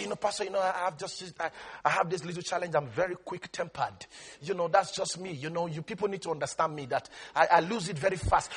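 soft, fast mock excuse; lightly voiced complaining believer; a touch of an excuse-maker.

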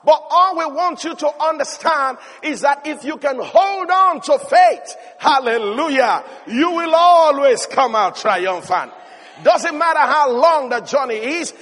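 loud, rising preacher's exhortation; full-force preaching. Acted. Preacher urging the congregation to hold on to faith, loud. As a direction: build it up and preach it out.

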